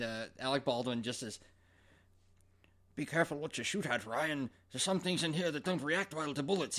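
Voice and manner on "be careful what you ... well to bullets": a hint of a mock Scottish accent; lightly mocking the Scottish accent; mocking Connery's accent. Baldwin mocking Connery's Scottish accent, lightly done. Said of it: "He totally mocks the accent".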